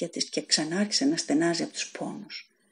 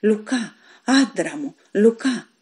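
calling out; 3 semitones higher, 4 dB louder, slower than usual. A narrator's reading. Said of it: "Ξαφνικά άρχισε να φωνάζει".

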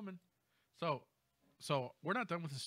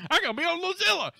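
much higher and louder, hyped-up brag; mock excited boast; 12 dB louder than usual. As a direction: brag like a proud fool. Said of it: "they'll be like".

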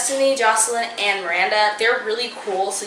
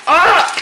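short, high, loud "ah!"; startled yelp; 8 semitones higher, 11 dB louder than usual. Real, not acted. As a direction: yelp suddenly at a mishap. Blender top will not go on.

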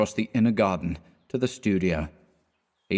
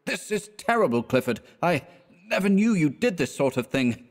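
higher, emotional dismay; upset and shaken; 8 semitones higher than usual. A narrator's reading. Hastings dismayed at drunk Clifford, higher and upset. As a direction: shaken friend who can't hide it. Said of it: "with an emotion he could not conceal".

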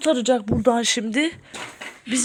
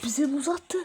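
very soft, secretive commentary; hushed whispering; 8 dB quieter than usual. Pretend. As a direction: murmur while spying from hiding. Spying secretly on the intruder.

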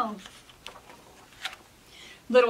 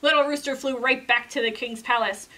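usual speaking voice

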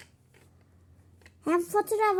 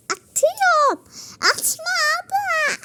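squealing; 13 semitones higher, 4 dB louder than usual. Pretend. Throwing plates, high squeals.

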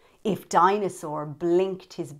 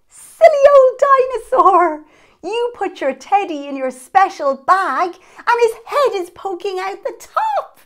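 much higher, louder, playful teasing; laughing; 11 semitones higher, 11 dB louder than usual. Acted. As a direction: tease him fondly with a laugh. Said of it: "You'd laugh and say".

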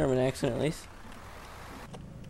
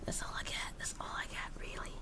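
whisper; 14 dB quieter than usual. Real, not acted. Whispered aside.

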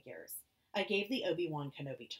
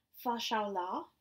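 Chinese; 6 semitones higher, 4 dB louder, slower than usual. Acted. Mother worried, in Chinese.

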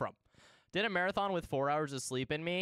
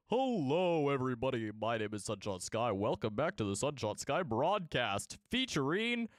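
booming cartoon broadcaster with swooping emphasis; middle-aged man voice; slower than usual. Streamer opening the broadcast and naming sponsors, middle-aged man voice. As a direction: announce it like a hammed-up older showman. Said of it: "Why'd you turn into a middle-aged man?".

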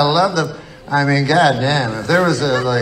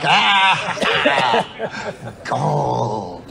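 deflated gold; 3 semitones higher than usual. Retold or imitated. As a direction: let the joy collapse into a whimper. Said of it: "You're like Daffy Duck".

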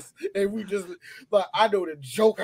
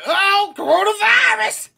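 stretched, squealing mock panic; 13 semitones higher, 6 dB louder, slower than usual. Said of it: "[name] said what I said".

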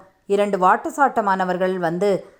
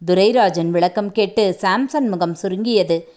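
ordinary delivery, nothing marked

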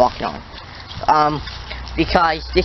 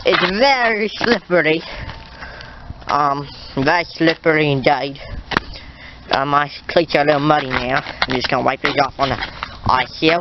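casual mock Australian accent; put-on Australian accent. Vlogger showing viewers the ice, mock Australian accent. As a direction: play a chatty Aussie outdoorsman.

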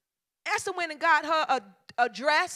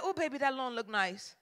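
sweet-talking; 6 semitones lower, 10 dB quieter than usual. Retold or imitated. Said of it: "I'm like".

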